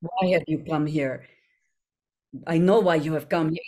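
lightly voiced Slavic-accented Jaheira; a hint of Jaheira's accent; Jaheira in character. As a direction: slip partly into a measured game character. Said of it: "do I turn into it like".

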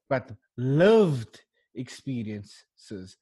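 lived experiences dragged out very slowly; slow emphatic phrase. Shown as a demonstration. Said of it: "that phrase we love so much".

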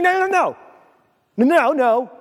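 high-pitched, hammed-up protest; protesting refusal. Preacher acts out refusing God's call, protesting refusal. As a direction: play-act digging the heels in.

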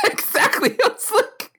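very high, squeaky with laughter; laughing agreement. Real, not acted. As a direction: barely get it out through real laughter. Cracks up at co-host's fat joke.

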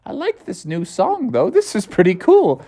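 hushed, pleasantly surprised mother voice; intrigued mom. Acted. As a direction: coo softly over a new favorite tune. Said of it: "she's like".

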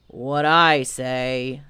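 low, very slow donkey drone; gloomy Eeyore. Acted. Eeyore airs his complaint to the expedition, low and gloomy. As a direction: drag the words out ponderously.

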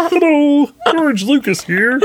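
high-pitched Kermit-like voice; bad celebrity impression that slides into Kermit; George Lucas. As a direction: do a squeaky mock director, close to laughing. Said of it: "it's more like Kermit the Frog".

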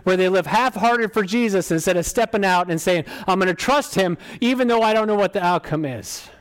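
high, forceful preacher's declaration; predicazione: fervent preaching. Acted. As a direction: proclaim it with rising conviction, high and loud.

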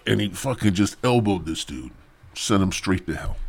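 usual speaking voice

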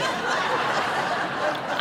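soft breathy laugh; unvoiced laugh. Real, not acted. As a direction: laugh under your breath. Laughing at spitting saints.

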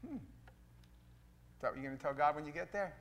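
ordinary talk

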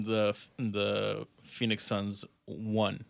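slow "won" drawled out; imitating "won". Mocking how people say won, slow. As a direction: draw out the retold "won". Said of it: "when I hear people say".

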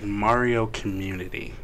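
ordinary talk

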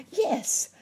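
high, loud exclamation; eager cry. A narrator's reading. Nurse Jane answering the plea for rescue, high loud cry. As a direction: cry it out high, volunteering at once. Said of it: "cried Nurse Jane".

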